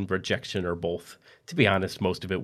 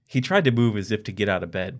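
ordinary delivery, nothing marked

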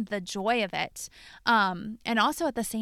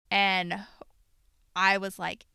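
ordinary talk, nothing marked

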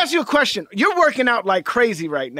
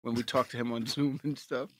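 stoned; 10 semitones lower, 12 dB quieter than usual. Real, not acted. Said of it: "you're so stoned right now".

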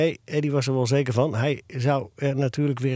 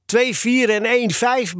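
slow grumble, pitched up; mildly grumpy, higher and drawn out; grumbling; 8 semitones higher, slower than usual. A narrator's reading. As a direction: grumble out the bad marks. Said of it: "bromde Tony".

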